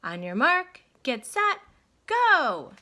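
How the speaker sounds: loud, rising shout, high on go; race start call. A narrator's reading. Referee starting the sailboat race with a loud high shout.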